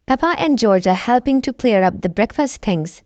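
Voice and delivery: usual speaking voice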